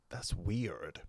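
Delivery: put-on weak, hushed American accent; whispered try at an American accent. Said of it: "that's about as good as my American accent gets".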